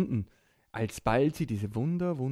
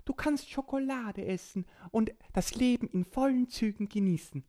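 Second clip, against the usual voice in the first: witch voice; 12 semitones higher than usual. Acted. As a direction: play a kindly witch. Witch soothes Borki.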